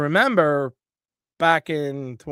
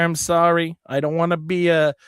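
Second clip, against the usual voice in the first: usual speaking voice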